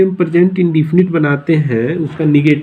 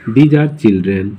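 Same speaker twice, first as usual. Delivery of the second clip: slow dictation; 6 semitones lower, much slower than usual. Shown as a demonstration. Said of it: "तो ये क्या हो गया?".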